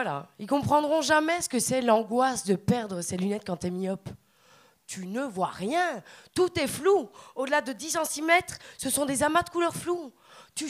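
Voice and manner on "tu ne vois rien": ordinary talk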